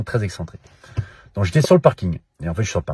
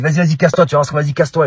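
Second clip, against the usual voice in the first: loud, higher angry get-lost; threatening shout; 6 semitones higher, 5 dB louder, faster than usual. Retold or imitated. Stranger shouts threats at Ragnar through the window, loud. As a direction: snarl him off. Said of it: "Il me dit".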